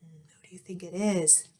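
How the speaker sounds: hushed whisper to the listener; whispered aside. A narrator's reading. Narrator whispering a teasing question to young listeners. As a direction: lean in and whisper the mystery. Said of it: "unvoiced whisper to listener".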